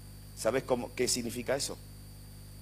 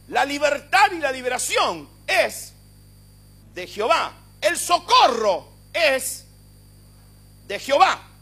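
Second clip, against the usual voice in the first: high, loud and slow proclamation; preaching shout; 12 semitones higher, 12 dB louder, slower than usual. Shown as a demonstration. Preacher explaining the verse to the congregation, shouting. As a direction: declare each phrase at full force. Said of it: "le voy a decir lo que significa".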